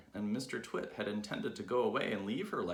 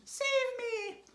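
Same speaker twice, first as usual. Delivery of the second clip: very high, loud plea; screaming; 4 dB louder than usual. Acted. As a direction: shriek it in terror. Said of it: "she screamed".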